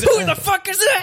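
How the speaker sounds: high-pitched indignant yell; jealous outburst. Acted. Partner confronting the stranger Marissa, jealous outburst. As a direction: demand it in a shrill jealous outburst.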